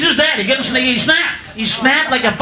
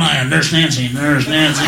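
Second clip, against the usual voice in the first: low, leering sing-song of a name; sleazy Nurse Nancy; 10 semitones lower than usual. Retold or imitated. Host in an adult theater calling himself Nurse Nancy, low and leering. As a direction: drop the voice low and creepy. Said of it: "in a fucking adult theater going".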